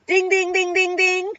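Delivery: high, loud, rapid dinging; ding like a bell at the top of the scale; imitated rising meter. Shown as a demonstration. Vlogger showing her hope level is way up, excited high dinging.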